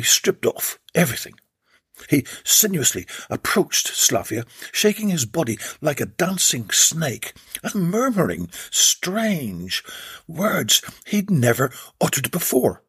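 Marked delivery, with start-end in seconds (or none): none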